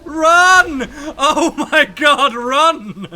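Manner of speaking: shrieking run while laughing; panicked laughing shout. Real, not acted. Fleeing a monster, laughing.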